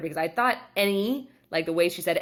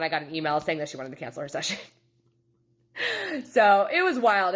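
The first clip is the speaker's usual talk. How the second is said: ordinary talk, nothing marked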